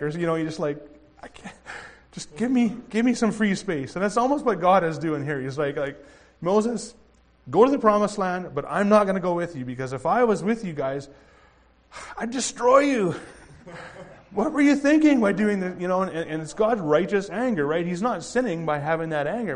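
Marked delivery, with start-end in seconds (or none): 1.2-3.9 overwhelmed parent. Retold or imitated. Parent pleading for space. 6.4-13.1 higher, louder God warning Moses off; a fed-up ruler waving Moses away; exasperated God. Acted. Preacher voices an exasperated God. 14.3-15.8 laughing scold. Acted.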